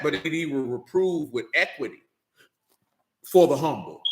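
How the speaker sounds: normal talking voice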